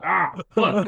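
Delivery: sharp high yelp of a curse; pained curse. Retold or imitated. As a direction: stub your toe and swear. Acted stumble on stair lip.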